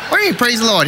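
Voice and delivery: high-pitched indignant question; incredulous outburst. Retold or imitated. To the friend praising his death vision, incredulous outburst. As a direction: call out in acted disbelief.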